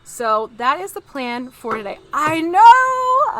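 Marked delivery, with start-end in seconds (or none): 2.1-3.4 excited squeal. Real, not acted. Squealing at the poor figure.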